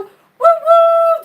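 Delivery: very high, loud whistle cry; hooting like a toy train for Rose; train whistle woo. Pretend. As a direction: imitate a steam whistle.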